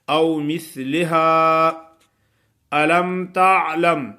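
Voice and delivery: slow chant with the waw lightly held; tajweed recitation. Acted. Teacher reciting for students, slow chant. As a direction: recite او ننسہا slowly.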